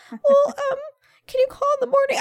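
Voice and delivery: put-on high pleading kid voice; scared child voice. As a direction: beg like a nervous little boy. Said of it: "I'm like".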